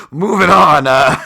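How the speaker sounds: loud, high "moving on" to change the subject; loud burst. Real, not acted. Host hurrying past his own awkward joke loudly. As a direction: blurt it out to escape the joke.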